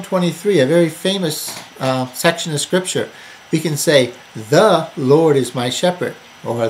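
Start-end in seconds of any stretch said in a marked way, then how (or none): 4.3-6.1 THE drawn out and punched; emphatic, lengthened "The" at the start; stress on THE. Shown as a demonstration. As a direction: lean hard on "The", stretching it. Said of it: "emphasize different words to bring out".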